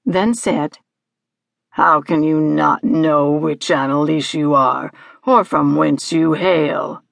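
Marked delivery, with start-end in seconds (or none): 1.7-7.0 low, pompous creature voice; imperious low-pitched rebuke; Hagski. Acted. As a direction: demand it grandly in a deep voice. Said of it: "Hagski paused for effect, then said".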